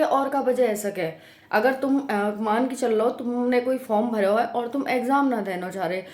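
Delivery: ordinary talk, nothing marked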